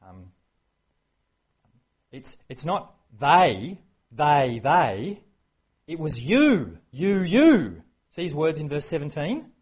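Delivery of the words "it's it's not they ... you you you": loud, slow pounding on each word; loud preacher's insistence; emphatic. Real, not acted. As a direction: hammer it home. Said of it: "emphatic preaching".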